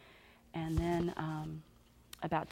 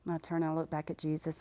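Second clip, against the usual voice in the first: ordinary delivery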